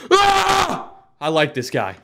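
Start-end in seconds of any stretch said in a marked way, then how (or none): 0.1-0.9 high imitation scream; short hammed-up "ah!"; mock panicked scream. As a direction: act out a crash victim's yell. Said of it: "people in his situation would be like".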